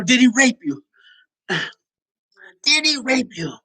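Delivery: high pressing question; mock concerned questioner.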